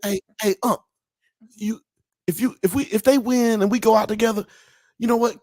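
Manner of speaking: high, excited imitation of a pumped-up friend; mock-excited athlete offering a celebration drink; imitating Ocho Cinco.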